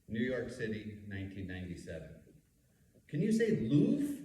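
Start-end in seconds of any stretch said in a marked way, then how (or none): 3.1-4.1 teasing. Retold or imitated. Teasing friend at a bar.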